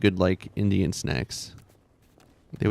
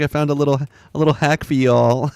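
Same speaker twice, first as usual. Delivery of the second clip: louder, higher chirpy influencer voice; hammed-up helpful hack-sharer; mock TikTok woman; 5 semitones higher, 5 dB louder than usual. Mocking the poop-hack TikTok, chirpy influencer voice. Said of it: "It's like".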